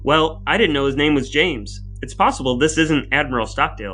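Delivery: ordinary delivery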